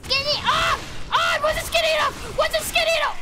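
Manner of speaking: high, loud, frantic questions; high-pitched alarm, words tumbling; panicked yelling. Real, not acted. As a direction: shriek it in a hurry. Panics squeezing through in game.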